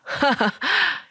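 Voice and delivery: high burst of acted laughter; mocking laugh. Drunk friend laughing at his friend's wife, mocking laugh. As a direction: laugh in her face.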